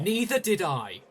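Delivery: snapping back. Acted. Snapping back at her denial.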